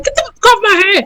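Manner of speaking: high, quick mock protest; retold indignant woman. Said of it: "She was like".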